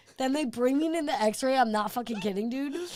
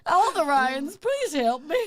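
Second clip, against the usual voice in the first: raised, louder comic wail for help; mock pleading; 6 semitones higher, 4 dB louder, slower than usual. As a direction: beg in hammed-up desperation. Retold plea to all the Ryans.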